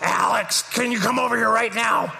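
calling across in a put-on old lady voice; neighbor's Roz voice calling. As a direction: neighbor summons the pastor in her Roz voice. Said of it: "neighbor calls him over".

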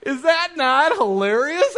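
high, loud, drawn-out amazement; amused disbelief. Real, not acted. Dad sharing his kid's story with the crowd, tickled. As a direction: stretch it out, marveling at how funny it is. Reacts to daughter's funny story.

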